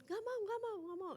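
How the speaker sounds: put-on high whiny child refusing; imitating a whining child. Mother imitating her daughter's screaming refusal, high whiny child. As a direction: pitch up high like a stubborn kid refusing. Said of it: "dia kayak teriak-teriak".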